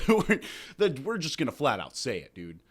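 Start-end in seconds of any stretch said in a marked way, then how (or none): none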